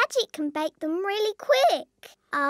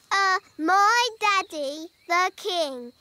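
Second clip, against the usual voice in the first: normal talking voice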